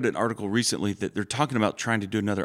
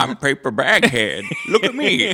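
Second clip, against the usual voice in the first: mock comedian; 6 semitones higher, 5 dB louder than usual. Impersonating paper bag comedian.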